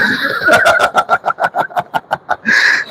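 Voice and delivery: breathy high laugh; amused chuckle. Real, not acted. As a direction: laugh it off lightly. Laughs after wahala joke.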